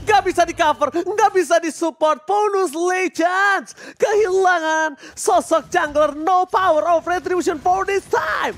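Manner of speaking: high, loud hype call; excited play-by-play. Real, not acted. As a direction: shout the turning point.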